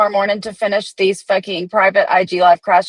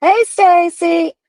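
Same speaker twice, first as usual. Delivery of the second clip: sing-song greeting; 10 semitones higher, 3 dB louder, much slower than usual. Real, not acted. Greeting a viewer in chat.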